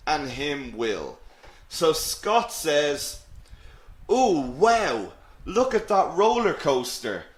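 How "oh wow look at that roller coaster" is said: high, eager exclamation; acted excitement. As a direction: play Scott thrilled at the sight. Teacher acts out Scott at funfair.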